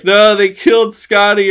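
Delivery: hammed-up wail of grief; almost crying. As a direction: retell it as a dismayed cry. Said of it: "I almost cried".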